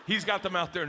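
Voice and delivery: ordinary delivery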